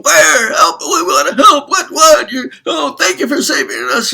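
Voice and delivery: high, loud frantic shouting; frantic crowd. Acted. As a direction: rush in desperate to help. Crowd rushing in to help.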